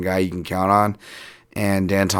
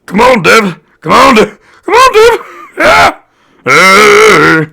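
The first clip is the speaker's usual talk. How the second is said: high yelled cheer, much louder; cheering shout; 12 semitones higher, 11 dB louder, slower than usual. Acted. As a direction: scream for him from the crowd. Cheering on a fighter.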